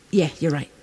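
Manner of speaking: low, soft, placating murmur; honeyed agreement. Acted. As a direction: agree meekly under your breath. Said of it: "the honey thing would be to go".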